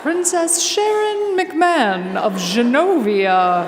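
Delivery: slow formal herald voice; grand announcement. Retold or imitated. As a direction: announce her like royalty, slowly. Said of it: "they announce you to the president".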